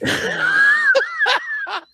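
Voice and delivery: high, shrieking laughter; big laugh. Real, not acted. Co-host cracking up after teasing host, high laugh. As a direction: burst into high, helpless laughing.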